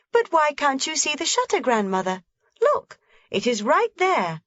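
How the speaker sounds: put-on lively little girl voice pitched high; a small girl pointing things out eagerly; young Heidi. A narrator's reading. Heidi telling grandmother about the shutter, bright and eager.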